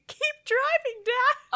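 squeaky retold scream; screaming son. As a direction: imitate a frantic boy, held back in volume. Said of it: "the son is just screaming".

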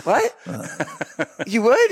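high, shocked question; shocked wife. Retold or imitated. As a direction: react in disbelief. Claire voiced, shocked.

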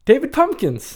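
high, laughing silly character voice. Pretend. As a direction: say it like a goofy sketch character. Repeats name in funny voice.

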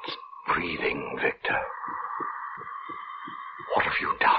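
horrified hush. Acted. Minister watching the creature breathe, horrified hush. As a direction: breathe it out in disbelief.

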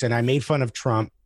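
ordinary delivery

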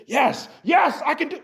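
high, shouted hype, imitated; hammed-up yell of self-belief; mocking a shout of self-belief. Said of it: "stay over there and say".

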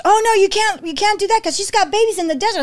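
mock-alarmed "oh no, you can't"; alarmed objection. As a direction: object urgently, as people did. People objecting to her plan.

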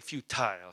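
"futile" said FEW-tile, long "i" ending; emphatic pronunciation. Shown as a demonstration. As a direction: give "futile" a full "tile" second syllable. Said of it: "sounds more emphatic".

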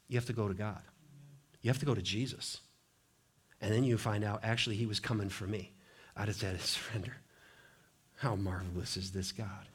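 low, very soft, intimate; hushed testimony. Real, not acted. Intimate testimony of coming to God.

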